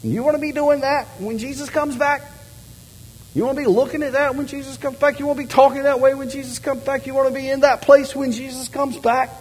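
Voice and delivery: put-on wag a finger like grandma warning the kids; hammed-up grandmother scolding. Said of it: "my grandmother used to say".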